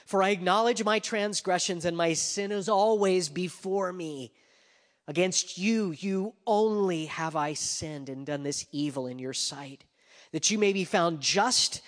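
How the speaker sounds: loud, high psalm reading; scripture reading. Acted. Preacher reads David's psalm aloud, declaiming. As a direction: proclaim the psalm to the room.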